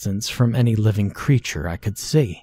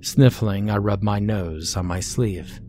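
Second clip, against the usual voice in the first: ordinary delivery, nothing marked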